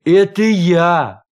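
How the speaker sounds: loud, drawn-out call; big, slow announcing cry. A narrator's reading. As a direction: shout it slowly through a door. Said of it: "крикнул из-за двери".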